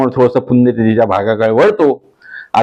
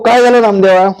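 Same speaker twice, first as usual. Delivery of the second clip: high, loud tender God asking; a higher, louder voice for God; God's voice; 8 semitones higher, 4 dB louder than usual. Acted. God kindly asking Namdev what happened, raised pitch.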